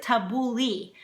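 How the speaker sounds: tabbouleh ending in a long ee; common mispronunciation. Shown as a demonstration. Cook showing how many people say it, mispronounced. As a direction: say tabbouleh as ta-boo-LEE. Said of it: "pronounce it tabbouleh".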